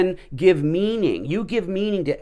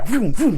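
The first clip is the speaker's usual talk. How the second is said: loud vroom vroom, higher pitched; car engine noise; 5 semitones higher, 6 dB louder than usual. Retold or imitated. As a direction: rev it like a race car going by. Race cars flying past.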